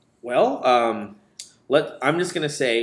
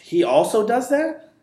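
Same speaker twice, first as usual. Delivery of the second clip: surprised; 10 semitones higher, 6 dB louder than usual. Acted. Shocked at sheriff news.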